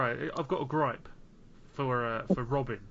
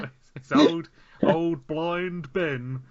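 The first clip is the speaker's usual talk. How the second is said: slow, loud, drawn-out greeting; loud slow mock greeting; 5 dB louder, much slower than usual. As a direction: call it out slowly and loudly. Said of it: "I'm like".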